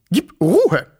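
high, loud sharp scolding of the dog. Acted. Fat woman scolds her barking dog Waldi, sharp and loud. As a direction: snap at the dog to hush. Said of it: "schimpfte sie".

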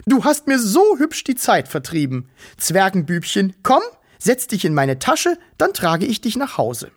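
put-on high, sugary baby-talk cooing; cooing fat woman. Fat woman coos at the little Wichtel, sugary high. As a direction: coo at him like a doting auntie.

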